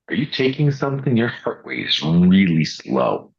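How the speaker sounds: mildly incredulous question, slowing at the end; quoted doctor. Retold or imitated. Doctor questioning a patient's heart rate, mild concern.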